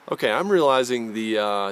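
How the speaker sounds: usual speaking voice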